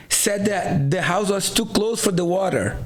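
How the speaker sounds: ordinary talk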